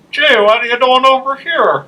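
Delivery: very high mock corner-of-mouth voice; loud, high imitation of a bad impressionist; Danielle. Mimicking a friend quoting a guy, high side-mouth voice. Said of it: "only talk out of the corner".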